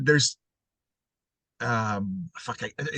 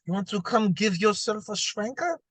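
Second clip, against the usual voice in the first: a hammed-up Russian accent, higher and teasing; mock Russian accent; 7 semitones higher than usual. Friend demos a mock Russian accent for the sexiest-accent question. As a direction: play a seductive Russian asking what he wants, with a mock Russian accent. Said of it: "soft Russian accent".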